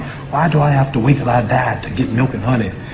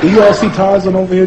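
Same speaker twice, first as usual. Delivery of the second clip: usual speaking voice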